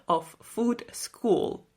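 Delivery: normal talking voice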